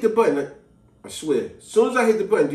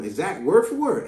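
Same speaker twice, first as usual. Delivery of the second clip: ordinary delivery, nothing marked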